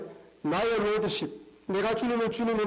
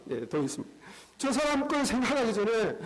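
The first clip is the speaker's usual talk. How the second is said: usual speaking voice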